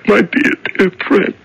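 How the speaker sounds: high, tearful sobbing voice; crying. Acted. Prisoner weeping to his mouse friend on her babies, crying. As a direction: weep tenderly, words breaking into sobs. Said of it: "Do you know I'm crying again".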